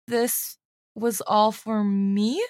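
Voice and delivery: slow, halting, hushed question; trembling voice. A narrator's reading. Disciple seeing his teacher's injuries, trembling. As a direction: falter through it slowly, rising at the end. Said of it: "He says in a trembling voice".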